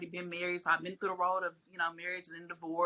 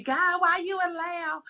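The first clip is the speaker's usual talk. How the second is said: whiny complaint; 10 semitones higher, slower than usual. Retold or imitated. Mock complaint to God.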